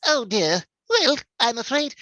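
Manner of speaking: high, fretful fussy robot voice; Fugitoid. Acted. Voice actor doing the requested line for a fan, fussy robot voice. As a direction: fret politely like a prissy droid. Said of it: "for Future Toy, could you say".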